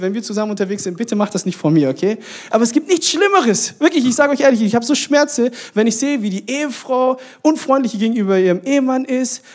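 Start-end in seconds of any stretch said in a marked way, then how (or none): 2.5-9.4 higher, louder, exasperated insistence; worked-up. Real, not acted. Preacher decries spouses being unfriendly to each other, worked-up. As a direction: preach it with rising heat, pitched up.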